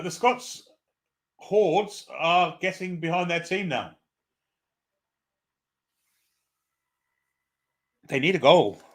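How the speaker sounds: hammed-up Scottish commentator; a light Scottish brogue on the call; mock Scottish accent. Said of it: "Can I do the rest of the commentary in a Scottish accent?".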